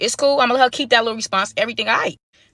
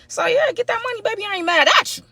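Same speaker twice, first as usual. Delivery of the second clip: much higher playful hype; mock cheer; 12 semitones higher than usual. Real, not acted. Narrator telling his date to take the money, high playful cheer. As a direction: cheer her on half-jokingly.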